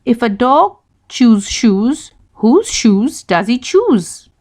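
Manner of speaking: slowly, each word clear; slow, careful tongue twister. Shown as a demonstration. Teacher reading slowly to young learners. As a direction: read it slow so every sound lands. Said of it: "So can we try that again?".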